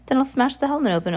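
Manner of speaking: high, quick boastful threat; crowing. A narrator's reading. Caged villain threatens the colony, crowing. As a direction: gloat it out high and fast.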